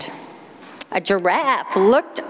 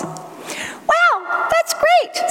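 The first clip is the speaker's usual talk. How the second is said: very high squeaky frog "Wow, that's great"; squeaked; much slower than usual. A narrator's reading. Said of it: "squeaked the frog".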